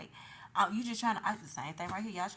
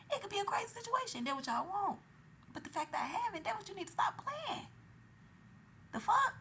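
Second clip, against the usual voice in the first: rising rant; 11 semitones higher than usual. Real, not acted. Warning people not to test her, rising outrage. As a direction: push it up into disbelief. Ranting about being dangerous.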